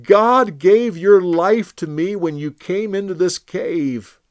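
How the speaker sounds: high, loud, earnest appeal; David pleading with King Saul from afar; impassioned plea. Acted. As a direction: plead your innocence with all your heart.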